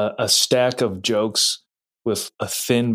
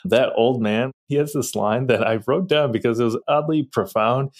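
ordinary talk, nothing marked

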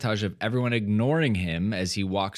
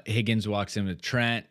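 ordinary delivery

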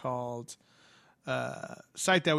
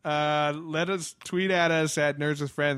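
ordinary delivery

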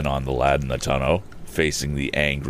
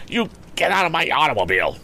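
higher, fast, indignant order; angry demand; 12 semitones higher, faster than usual. A narrator's reading. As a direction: throw him out of the car. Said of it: "demanded Andy".

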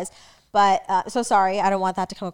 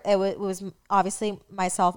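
usual speaking voice

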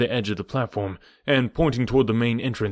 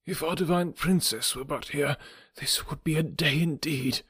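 grieving slave; 6 semitones higher, 5 dB quieter than usual. Acted. Slave mourns absent princess.

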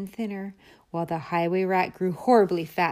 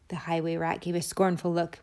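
ordinary delivery

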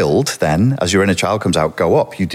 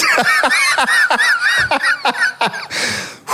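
long high laughing fit. Real, not acted.

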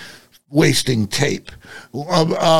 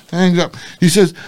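ordinary talk

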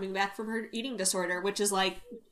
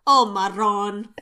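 slow Jersey Italian exclamation; New Jersey Italian accent; 4 dB louder, slower than usual. Retold or imitated. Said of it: "what do they say in New Jersey".

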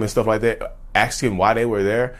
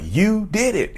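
high, emphatic mock accusation; retold pointed accusation; insistent accusation; 10 semitones higher, much slower than usual. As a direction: imitate someone insisting, pitch jumping up. Wife insists in retold quarrel.

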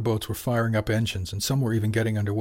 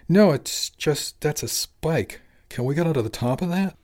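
high, slow, startled protest; alarmed and taken aback; surprised; 5 semitones higher than usual. A narrator's reading. Startled reaction to the spike.

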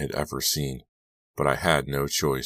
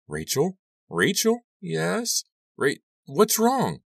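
much higher, frantic calls; shrill with growing panic, slowing at the end; alarmed; slower than usual. A narrator's reading. Girl on the phone to crying friend, much higher frantic.

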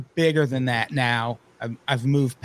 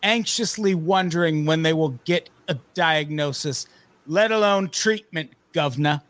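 mock British accent; 4 semitones higher than usual. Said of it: "a stereotypical British accent".

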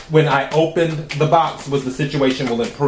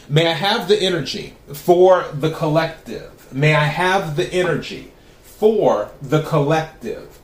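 invocation. Acted. Invokes cards while shuffling.

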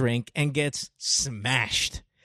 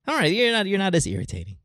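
ordinary talk, nothing marked

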